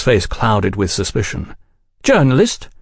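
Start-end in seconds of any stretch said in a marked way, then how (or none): 2.0-2.7 high, challenging questions; suspicious demand. A narrator's reading. Velasco questioning Oliphant's profession, suspicious demand. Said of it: "he demanded".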